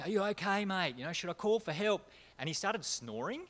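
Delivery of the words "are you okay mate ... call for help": loud concerned yell. Retold or imitated. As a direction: shout it to rouse him. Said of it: "I was, like, yelling".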